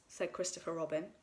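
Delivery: ordinary delivery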